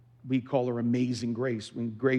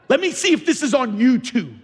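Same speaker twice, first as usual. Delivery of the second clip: high, loud mock-baffled voice; mock puzzled God; 11 semitones higher, 9 dB louder than usual. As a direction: play a God who has to look it up. Mocking God searching online.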